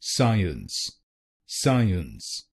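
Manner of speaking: साइंस slow, in two syllables "sai-ans"; साइंस drawn out slowly as "sai-ans"; correct pronunciation. Shown as a demonstration. Teacher modelling the correct form for students, saying साइंस slowly as "sai-ans". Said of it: "इसकी सही pronunciation है".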